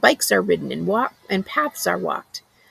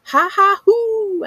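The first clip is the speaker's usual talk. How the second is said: high, loud ha ha hoo cackle; a wild creature jeering at leashed dogs; mocking animal laugh; 13 semitones higher, 7 dB louder, slower than usual. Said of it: "I laugh like this".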